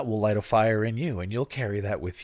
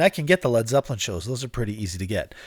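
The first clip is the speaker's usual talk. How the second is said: ordinary talk, nothing marked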